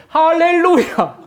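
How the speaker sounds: a high, drawn, rejoicing hallelujah; slow joyful hallelujah. Retold or imitated. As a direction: draw out hallelujah with glee. Cousin's joyful hallelujah reply.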